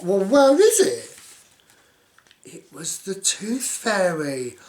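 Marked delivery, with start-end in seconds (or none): 0.0-1.2 high, loud, slow where is it; eager search. Real, not acted. Reader looking for the fairy on the page, excited.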